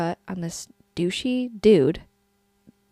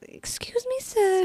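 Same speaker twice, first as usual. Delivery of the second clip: much higher, softer polite voice; high squeaky voice; 14 semitones higher, 4 dB quieter, slower than usual. Pretend. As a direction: squeak it like a prim gentleman. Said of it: "And he'd be like".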